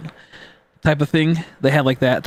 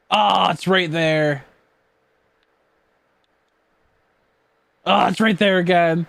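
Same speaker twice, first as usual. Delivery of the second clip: higher, louder frantic gamer; panicking gamer; 5 semitones higher, 3 dB louder than usual. Real, not acted. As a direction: panic as the target slips away. Missing the shiny in the game.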